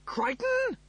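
very high, loud call; yelling. A narrator's reading. Rimmer summoning the mechanoid, yelling. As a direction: yell the name in alarm. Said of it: "Rimmer yelled".

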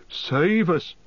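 high-pitched cry of dread; alarmed cry. A narrator's reading. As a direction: exclaim in fright as an innkeeper. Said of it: "cried Mister Butterbur".

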